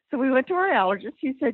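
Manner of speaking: breathless restart, a bit hurried; out of breath. Real, not acted. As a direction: still catching breath after stairs. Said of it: "I'm sorry, I'm out of breath".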